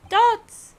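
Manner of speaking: dramatic shout. Acted. Voicing a dramatic courtroom cry.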